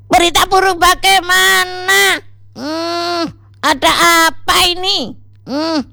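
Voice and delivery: very high-pitched, puzzled character voice; alarmed questioning. A narrator's reading. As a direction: demand to know the bad news, high-pitched. Sajid reacts to bad news. (bertanya heran, suara melengking tinggi)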